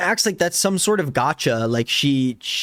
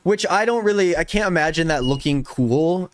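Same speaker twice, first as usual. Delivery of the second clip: usual speaking voice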